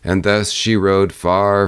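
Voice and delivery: ordinary delivery, nothing marked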